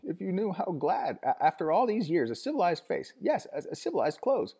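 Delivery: high, tearful rush of words; high sobbing crone voice; gushing old woman. A narrator's reading. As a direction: pour it all out to the visitor. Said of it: "the torrent of words flowed sobbingly".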